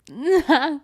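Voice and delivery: high, loud short laugh. Acted. Reacts to the spurs gag.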